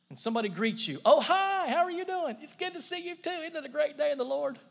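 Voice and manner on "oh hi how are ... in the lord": high, over-bright put-on cheer; fake churchy greeting. Churchgoer faking joy at a greeting after a fight in the car. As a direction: pretend to be thrilled to see them. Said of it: "We pretend".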